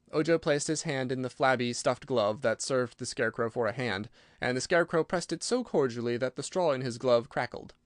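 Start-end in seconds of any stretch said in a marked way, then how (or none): none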